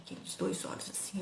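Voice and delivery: hushed, breathless hint of the scary eyes; creepy whispered aside; spooky whisper. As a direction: whisper it like a secret in the dark. Eerie whisper describing the eyes.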